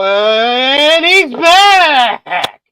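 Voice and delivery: loud, stretched ring-announcer yell; announcer call. Acted. Hosts welcoming a returning friend, with a drawn-out announcer yell. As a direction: belt it out like a wrestling announcer.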